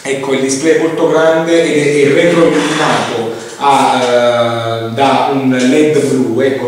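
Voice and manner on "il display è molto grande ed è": usual speaking voice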